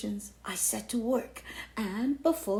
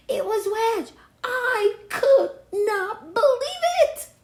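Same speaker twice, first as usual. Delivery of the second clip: shocked outburst; 13 semitones higher, 10 dB louder than usual. Acted. Shocked by rival.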